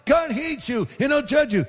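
high-pitched mock yelling at normal volume. Street preacher keeps yelling over the young pastor, high mock yell. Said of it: "he's still yelling".

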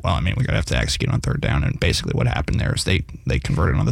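very low, fast coach impression; Bill. Guest showing co-hosts his coach impression, low quick monotone. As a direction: drop the pitch right down and rattle it off like a coach. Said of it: "Like, I can do Bill".

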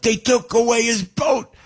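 high, slow shouted answer; imitated yell from the crowd. A man in the audience shouts the answer, high and slow. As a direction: call it out high and slow from the back. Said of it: "And the guy yells out".

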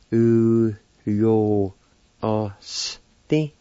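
long, separated syllables in "U yo o s te". Shown as a demonstration. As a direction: sound out "U yo o s te" slowly with breaks. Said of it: "It's pronounced like this".

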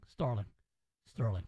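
low mock Southern accent; Jodie Foster. Host mocking Agent Starling's name in a Southern accent. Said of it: "her Southern accent as Special Agent Starling".

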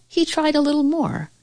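ordinary delivery, nothing marked

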